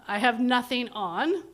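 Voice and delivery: usual speaking voice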